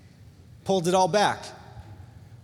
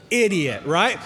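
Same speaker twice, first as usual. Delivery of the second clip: usual speaking voice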